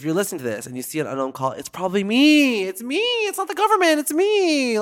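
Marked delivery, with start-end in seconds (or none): 1.5-4.8 higher, louder, hyped-up announcement; excited. Real, not acted. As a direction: shout it out to the fans. Excited call-to-camera.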